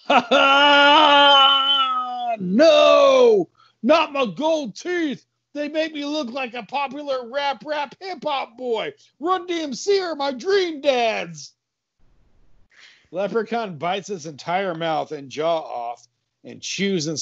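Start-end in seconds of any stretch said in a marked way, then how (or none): 0.1-11.5 high, dramatic wailing protest; hammed-up high-pitched pleading; alarmed victim. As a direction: cry out loud, then plead in a high voice.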